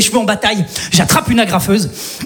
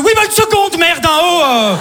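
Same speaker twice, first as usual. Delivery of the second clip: irritated snap at queue; 6 semitones higher, slower than usual. Acted. Snapping at queue behind.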